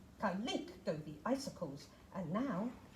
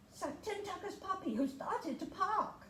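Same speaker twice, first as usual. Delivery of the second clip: storyteller surprise; 9 semitones higher than usual. A narrator's reading. Puppy appears, read higher.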